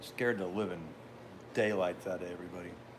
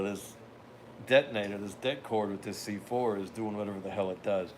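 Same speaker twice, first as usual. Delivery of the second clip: usual speaking voice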